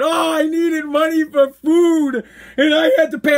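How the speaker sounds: drawn-out high mock wail; whining. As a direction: imitate a busted mother whining for pity, slowly. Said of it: "Whining like".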